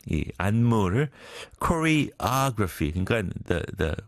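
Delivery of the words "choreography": "choreography" slowly, stress on "o"; stress on second syllable. Shown as a demonstration. Host shows listeners where the accent falls. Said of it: "the accent is on the, 어, O".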